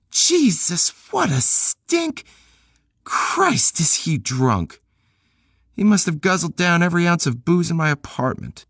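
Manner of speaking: high woman's voice, slowed in disgust. Acted. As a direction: voice Peg recoiling from the stink. Narrator voices Peg, disgusted.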